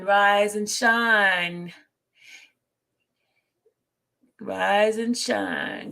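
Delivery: very slow, stretched words; drawn-out wake-up call. Real, not acted. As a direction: draw out each word like a wake-up call. Wake-up call to her audience.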